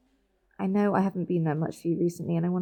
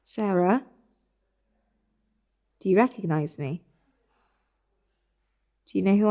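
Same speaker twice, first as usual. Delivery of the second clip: gentle questioning. Acted. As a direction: ask her name gently, checking she hears. Gently checking if she responds.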